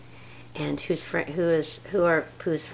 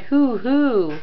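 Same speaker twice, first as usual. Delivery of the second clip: higher, louder owl hooting; 8 semitones higher, 6 dB louder than usual. Pretend. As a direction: hoot like an owl for the kid. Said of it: "Ooh, that's right".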